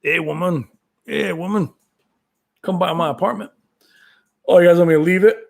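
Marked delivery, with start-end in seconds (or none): 0.0-3.5 Mister T impression. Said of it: "Mister T was scary".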